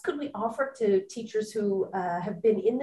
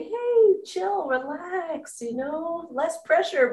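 high, drawn-out playful "relax"; teasing, slow, lilting quote; playful mock chill; 8 semitones higher, slower than usual. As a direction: imitate easygoing advice, stretched out and high. Playfully voices guest's laid-back advice.

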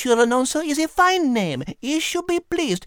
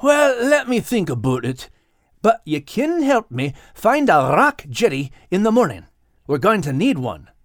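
put-on lower, Scottish-accented cat voice; Max the Scottish cat; 5 semitones lower than usual. Max hinting to Kate at a secret plan. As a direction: keep a secret with a sly grin. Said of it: "replied Max".

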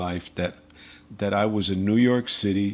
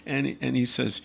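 ordinary talk, nothing marked

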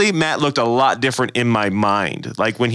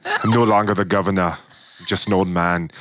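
Arnold impression; 3 semitones lower than usual. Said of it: "I almost did Arnold again".

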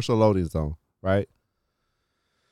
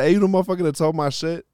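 confrontational; 7 semitones higher, faster than usual. Retold or imitated. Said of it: "I'm feeling a little confrontational".

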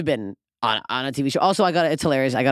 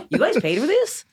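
incredulous mock; 10 semitones higher than usual. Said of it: "I'm like".

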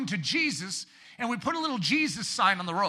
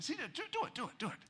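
much softer, coaxing encouragement; soft urging; 7 semitones higher, 11 dB quieter, faster than usual. Retold or imitated. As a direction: nudge someone gently to act. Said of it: "He's like".

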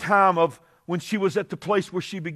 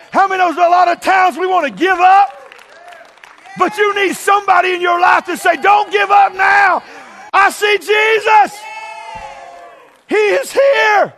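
fervent preaching; 12 semitones higher, 8 dB louder than usual. Real, not acted. Preaching at full force.

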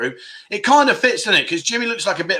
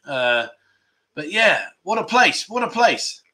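ordinary delivery

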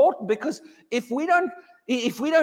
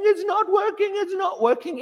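mock whining; 9 semitones higher than usual. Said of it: "me bawling and scrawling on the floor and moaning and crying".

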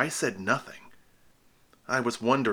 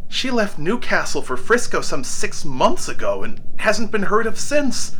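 much higher, much louder, rushing with recognition; excited outburst; 11 semitones higher, 12 dB louder than usual. Acted. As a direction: burst out with the news. Narrator recognises the lost ship.

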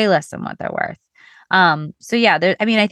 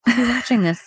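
laughing mock newscaster; news anchor voice; 8 semitones higher than usual. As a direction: play it like a TV anchor. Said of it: "I feel like I'm back on a news show".

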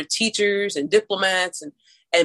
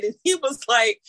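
normal talking voice

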